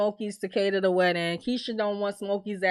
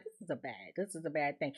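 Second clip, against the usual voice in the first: much lower and softer; hushed warning; 9 semitones lower, 15 dB quieter, faster than usual. Retold or imitated. Viewer warning Tiffany under her breath, low and soft. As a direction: mutter it like a bad omen.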